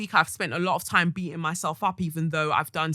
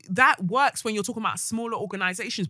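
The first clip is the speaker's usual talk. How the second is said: ordinary talk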